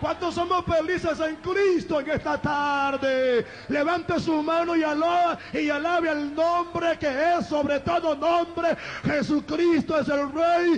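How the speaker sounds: high, sustained shouted exhortation, words stretched; full-force preaching. Real, not acted. Preacher rallying the congregation to praise Christ, high sustained preaching shout.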